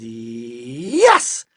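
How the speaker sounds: "Yes" with a long held start, bursting out loud; "Yes" swelling to a shout; stubborn insistence. A narrator's reading. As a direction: stretch the start of "Yes", then explode. Man argues back stubbornly.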